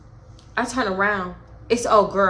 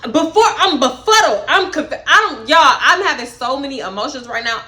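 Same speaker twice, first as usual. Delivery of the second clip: usual speaking voice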